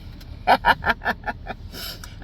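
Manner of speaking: long chuckle at own joke; amused, drawn-out laughing. Real, not acted. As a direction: let a long laugh roll out.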